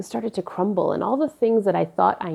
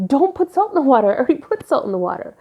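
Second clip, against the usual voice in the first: higher, louder, fast insistence; exasperated yell; 7 semitones higher, 5 dB louder, much faster than usual. Retold or imitated. Daughter scolding her mom about salted water, loud and fast. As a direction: rattle it off, fed up.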